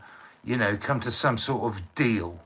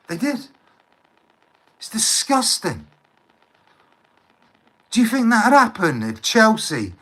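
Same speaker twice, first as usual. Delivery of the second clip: much higher, heated and quick; indignant rant; 6 semitones higher than usual. Real, not acted. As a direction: rattle off outraged questions. Indignant rant about football crowds.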